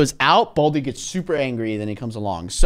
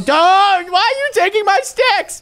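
outraged shout. Real, not acted. Bully steals sticks.

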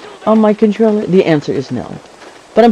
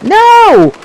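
high, dismayed shout; loud, drawn high wail of no; real protesting cry; 13 semitones higher, 4 dB louder than usual. Real, not acted. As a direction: cry out as the drone is hit. Drone being shot.

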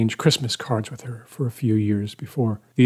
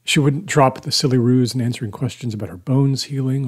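ordinary delivery, nothing marked

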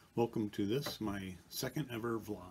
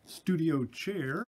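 higher, louder and drawn-out slowly, like a showman; mock grand announcement; 7 semitones higher, 6 dB louder, much slower than usual. To viewers, returning to his studio chair: slow, grand announcement. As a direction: stretch it out with a proud flourish.

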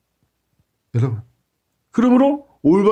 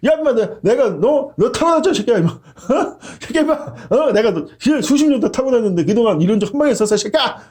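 donkey arguing back; 4 semitones higher than usual. Acted. Donkey berating its rider, rough mock scolding. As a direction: bark it like an offended beast of burden. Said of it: "이러면서 막 따지는 거예요, 나귀가".

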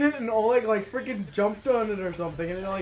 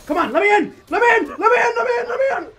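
loud high frantic yelling; shouting; 12 semitones higher, 9 dB louder, faster than usual. Real, not acted. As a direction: bang on the door and yell. Trying to get into plane.

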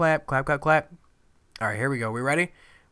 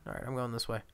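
ordinary talk, nothing marked

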